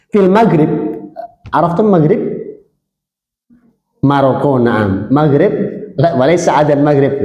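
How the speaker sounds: ordinary delivery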